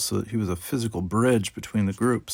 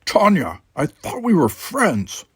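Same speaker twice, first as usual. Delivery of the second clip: louder, higher, slow hurt plea; hurt appeal; 8 semitones higher, 5 dB louder, slower than usual. Acted. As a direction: reach out, wounded. Worf pleading.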